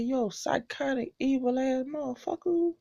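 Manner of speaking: hushed muttered cursing at the villain; muttered insult. Retold or imitated. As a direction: mutter it so only you hear. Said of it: "I said".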